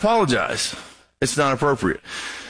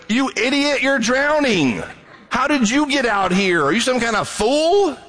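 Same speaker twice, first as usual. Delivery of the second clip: high, scornful jeer at a drowning man; mocking voice pitched well up; mocking rescuer; 8 semitones higher than usual. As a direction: sneer down at someone struggling in the water. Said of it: "you don't mock the drowning person".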